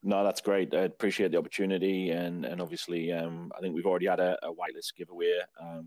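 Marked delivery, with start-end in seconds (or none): none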